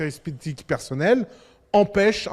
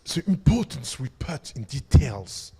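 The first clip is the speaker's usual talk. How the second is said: lower, slow British designer voice in English; British English accent; 5 semitones lower, slower than usual. Retold or imitated. Speaker imitating Apple's British designer pitching the iPhone, British accent. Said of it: "avec son accent anglais".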